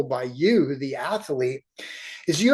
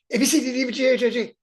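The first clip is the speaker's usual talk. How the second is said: fast, higher slurred-together letters; rushed cheating alphabet; 7 semitones higher, much faster than usual. Retold or imitated. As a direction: run A B C together like a kid. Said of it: "No cheating like I did when I was in kindergarten".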